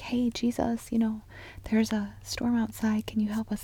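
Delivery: hushed, polite whispered request; whispering. Acted. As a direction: whisper it timidly, as if not to disturb. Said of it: "whispering versus, like, all caps".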